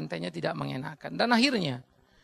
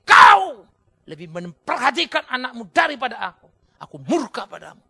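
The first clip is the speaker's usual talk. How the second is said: loud furious shout; God's fury; 4 semitones higher, 13 dB louder than usual. Acted. God shouts at Eli, furious loud shout. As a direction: thunder it as an angry God. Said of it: "Marah sekali Tuhan".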